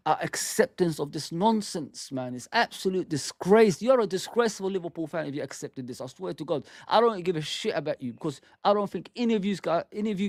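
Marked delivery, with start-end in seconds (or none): none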